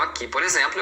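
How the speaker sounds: normal talking voice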